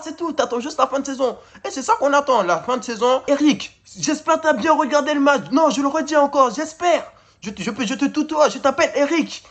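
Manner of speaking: high-pitched, exasperated scolding; fed-up rant. Real, not acted. Disappointed fan calling out the coach Eric, fed-up and high-pitched. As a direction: plead indignantly, voice pushed up high.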